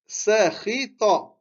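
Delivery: Sakhita stretched out, syllable by syllable; teacher modelling the word slowly; slow careful reading. Shown as a demonstration. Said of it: "Le mot d'après".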